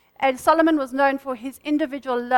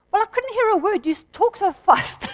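higher-pitched mock complaint; retold complaint; 6 semitones higher than usual. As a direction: imitate a listener protesting, pitch lifted.

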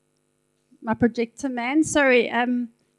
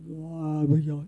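mock monster growl; 9 semitones lower, slower than usual. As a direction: growl it like a cartoon devil. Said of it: "he's not gonna come".

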